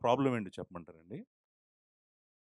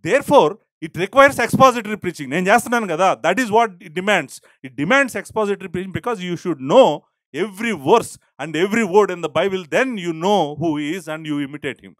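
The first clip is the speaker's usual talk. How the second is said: slow, loud and higher, each phrase hammered; fervent preaching; 5 semitones higher, 11 dB louder, much slower than usual. Real, not acted. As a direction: preach it hard and deliberate. Preaching at full force. (ఉద్వేగంతో గట్టిగా బోధిస్తూ)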